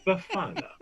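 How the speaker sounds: teaching the name Befana, clearly. Shown as a demonstration. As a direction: say Befana as beh-FAH-nah. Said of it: "Can you say that with me?".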